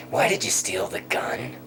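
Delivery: imitating a cop demanding a reason; acted interrogation. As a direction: press the suspect for an answer. Acted police interrogation of boy.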